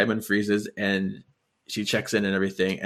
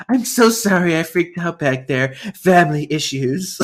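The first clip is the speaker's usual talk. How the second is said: slow, higher, syrupy sweetness; sugary apology; 6 semitones higher, 4 dB louder, slower than usual. Retold or imitated. Gabrielle apologizing to the sisters for her outburst, slow and sugary. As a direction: sweeten every word to smooth it over. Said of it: "just, like, so sweet".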